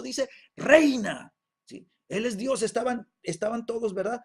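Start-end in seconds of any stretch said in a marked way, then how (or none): 0.6-1.3 loud, high, ringing command; powerful proclamation. Acted. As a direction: thunder out the single word from the pulpit. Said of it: "esta voz potente, potente, que declaraba". (voz potente y atronadora)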